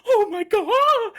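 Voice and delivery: drawn-out tearful shriek; crying scream. Retold or imitated. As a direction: wail it out slowly like a terrified woman. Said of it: "it screams and cries".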